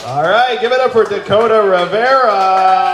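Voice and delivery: loud, drawn-out emcee call to the crowd; hyped stage call. Real, not acted. Host bringing the comic up to the stage, loud slow emcee call. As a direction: boom it out like a ring announcer.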